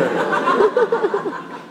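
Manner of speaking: squealing laugh; high laugh. Real, not acted. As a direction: crack up laughing. Laughing at own story.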